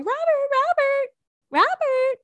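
very high, drawn-out name calls; imitating a singsong wake-up call; calling a sleeping pet. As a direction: stretch the name in a high coo to rouse a pet. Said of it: "I'll be going".

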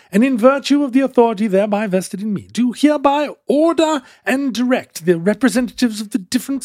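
high, stately mock-British proclamation; pompous imperial decree in hammy British accent; bad British accent. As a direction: declaim it in a dubious put-on British accent. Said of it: "whatever that accent is".

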